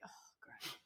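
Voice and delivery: a breathless whispered oh great; deflated, whispered irony; whispered sarcasm. Retold or imitated. Hearing from her dad that the tyres are cracked, whispering sarcastically.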